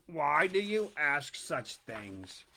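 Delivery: low, slow crocodile lure; sly crocodile. A narrator's reading. Crocodile luring the elephant's child, low and slow. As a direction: coax the little one closer, slowly.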